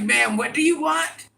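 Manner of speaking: high mock-impatient question; imitated impatient server. Server to a fumbling customer, mock impatience. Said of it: "They'd be like".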